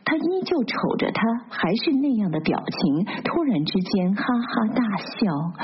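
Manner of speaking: plain reading voice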